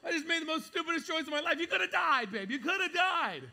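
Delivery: high, loud, fast panicked wail; mock panic. Husband realises wife drove past danger, loud laughing panic. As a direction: ham up the horror, laughing. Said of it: "I'm like".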